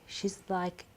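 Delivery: ordinary delivery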